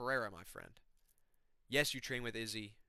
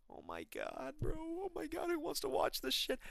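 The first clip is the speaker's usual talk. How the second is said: exasperated groan; 14 semitones higher, 3 dB quieter than usual. Real, not acted. Groaning at a dull matchup.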